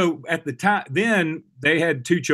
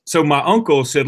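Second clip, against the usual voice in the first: usual speaking voice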